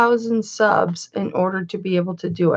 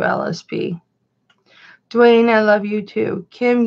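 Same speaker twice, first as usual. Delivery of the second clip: ordinary delivery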